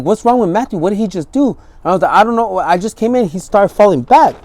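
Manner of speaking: high, loud frantic talk; rattled fast words in fear; frightened friends over a collapsed man; panicked. Retold or imitated. Panicked exchange over overdose.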